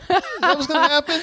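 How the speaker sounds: high sarcastic repeat; mock disbelief. Real, not acted. Friend mocking the prison factory plan, high sarcastic repeat.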